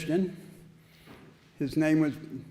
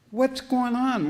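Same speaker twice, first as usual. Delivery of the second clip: alarmed question; 9 semitones higher, 4 dB louder than usual. Retold or imitated. Newcomer sees chained people.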